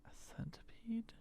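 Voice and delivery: real hushed whisper to himself. Real, not acted. Player reacting to odd item, whispered. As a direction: breathe it out unvoiced. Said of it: "own whispered reaction".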